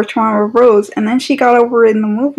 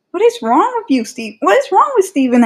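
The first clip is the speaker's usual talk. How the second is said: exasperated; 7 semitones higher than usual. Real, not acted. Scolding Steven.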